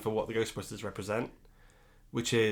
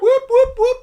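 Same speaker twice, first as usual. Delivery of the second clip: very high, loud whoops; excited whooping; 15 dB louder than usual. Real, not acted. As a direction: cheer like a hype man. Cheering sign-off.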